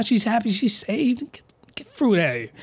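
whispered scoff; disgusted whisper. Real, not acted. Scoffing at the story turning wholesome, whispered. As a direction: hiss it under the breath in disgust.

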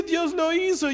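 high, wailing mock sob; hammed-up weepy exclamation; imitated tearful cry. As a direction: cry the words out like an overcome woman. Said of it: "y lloraba, lloraba".

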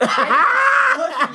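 laughing shout. Real, not acted.

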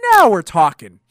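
loud, emphatic burst of enthusiasm; loud approval. Real, not acted. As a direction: belt it out, stretching the repeat. Enthused reaction to imagined match.

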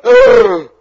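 a high, loud exasperated ugh; frustrated groan. Retold or imitated. Preacher groaning at being eight chapters behind, loud and high. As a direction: let out a big groan of frustration at falling behind. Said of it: "I'm going".

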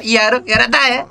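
high mock Madurese accent; a hint of a Madurese accent. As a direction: play a Madurese speaker learning Indonesian. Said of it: "Itu orang Madura".

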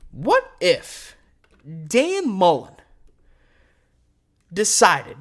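ordinary talk

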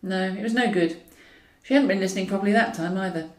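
plain reading voice

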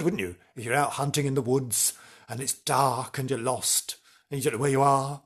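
ordinary talk

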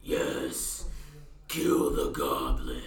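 low, slow whispered axe voice; evil axe voice. Acted. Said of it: "You hear the axe go".

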